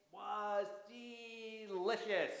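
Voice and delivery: stretched-out, savoring delicious; slow savoring. Acted. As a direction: draw out delicious like a tasty treat. Drawn out ending.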